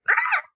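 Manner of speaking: sudden high squeak of alarm; mock startled yelp. Player imitating her panicked reaction, high yelp. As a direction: shriek like you nearly clicked wrong. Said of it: "I would have, like, gone, like".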